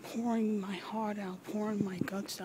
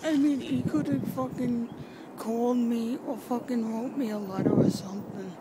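louder, higher, hurt and furious; emotional outburst; 3 semitones higher, 7 dB louder than usual. Acted. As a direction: let the grief boil into yelling. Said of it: "oh my God, I'm gonna get emotional".

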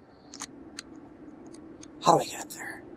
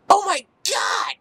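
much higher slow cry; startled shout; slower than usual. Real, not acted. Gamer shocked as his character dies, high shout. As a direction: yelp in shock. Sudden game danger.

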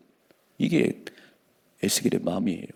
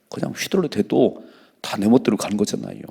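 ordinary talk

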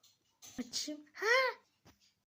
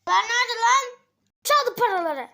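louder, higher shocked rant; angry outburst; 7 semitones higher, 8 dB louder than usual. Acted. Finding the till empty, shouting in anger. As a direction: blow up at the stolen money. Finds cash box empty.

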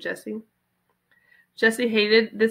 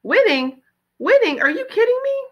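rising, louder, higher each time; mock outrage; 13 semitones higher, 3 dB louder than usual. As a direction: build up the incredulity. Paul mocks the idea of winning.

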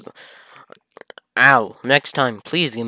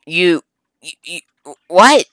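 stammer, then a high, loud what; stunned disbelief; 6 semitones higher, 8 dB louder than usual. Real, not acted. Reading the line and shocked at what it says. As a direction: caught off guard, voice jumping up.

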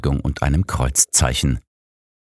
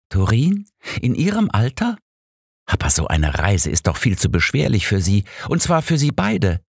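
convinced; 7 semitones higher than usual. A narrator's reading. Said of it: "sagte Alice überzeugt".

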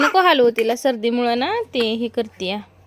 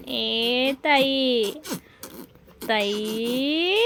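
very slow, low, soft stretched calling of the name; playful low drawn-out call to the little one; 3 semitones lower, 5 dB quieter, much slower than usual. Acted.

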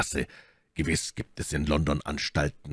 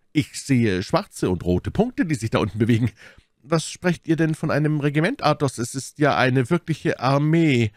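high, animated lookout voice; excited D'Artagnan; 10 semitones higher, 4 dB louder than usual. A narrator's reading. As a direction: report the approaching army, pitched up.

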